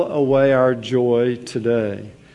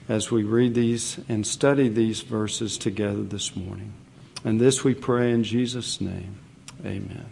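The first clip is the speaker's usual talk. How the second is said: prayer; 4 semitones lower, 4 dB quieter than usual. Real, not acted. Prays before the sermon.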